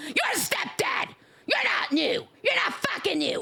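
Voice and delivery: hammed-up yell at a viewer; mock yell. Teasing a longtime viewer, mock shouting. Playful yelling at a chatter.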